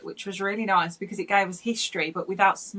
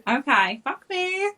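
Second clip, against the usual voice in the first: high, eager mock consent; eager reply; 7 semitones higher, 3 dB louder, slower than usual. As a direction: answer eagerly, playful. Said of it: "I'm like".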